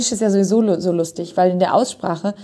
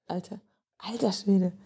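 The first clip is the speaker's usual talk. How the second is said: under the breath, softer; muted mock indignation; 10 dB quieter than usual. Shown as a demonstration. Testing how the idiom is stressed, muted indignation. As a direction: try out the exclamation quietly. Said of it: "es ist eher so Entrüstung".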